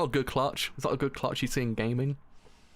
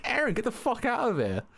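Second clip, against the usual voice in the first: mock dismissive character; 11 semitones higher than usual. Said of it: "He's like".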